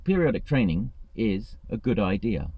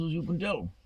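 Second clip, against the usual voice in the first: self-mocking aside; 9 semitones higher, 6 dB quieter than usual. Real, not acted. Ironic about his presentation skills.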